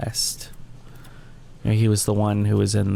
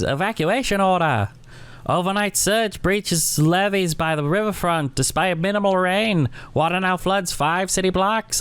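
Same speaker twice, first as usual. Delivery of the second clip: news read; 7 semitones higher than usual. Acted. Reads newspaper report aloud.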